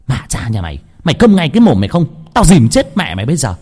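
loud, fast, high tirade; angry scolding. A narrator's reading. Witch bawling at the boy she is dousing, angry scolding. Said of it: "vừa quát choa choa".

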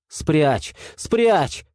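rising frightened cry; shouting. A narrator's reading. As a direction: cry out in terror, pitch jumping up. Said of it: "закричал".

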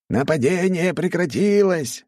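high, elated guardsman report; joyful exclamation. Acted. Demon guardsman reporting the attack is over, joyful. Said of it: "радостно воскликнул он".

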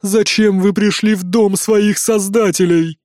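booming giantess voice. Acted. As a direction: speak slowly from a great height. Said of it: "голос великанши".

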